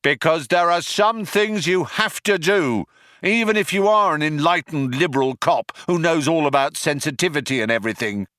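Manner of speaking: high calling voice, easing at the end; shouted justification. A narrator's reading. Cop justifies himself to Trillian, shouted. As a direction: shout the explanation across.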